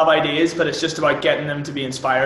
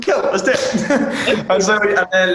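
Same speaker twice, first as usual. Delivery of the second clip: laughing; 4 semitones higher, slower than usual. Retold or imitated. Retelling agreeing to the idea.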